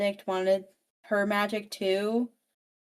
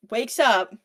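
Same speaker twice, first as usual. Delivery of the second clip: ordinary talk, nothing marked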